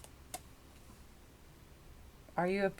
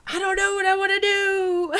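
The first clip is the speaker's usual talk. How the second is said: mock dithering; 10 semitones higher, 3 dB louder than usual. Mock indecisive voicing of querent.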